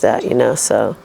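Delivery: ordinary talk, nothing marked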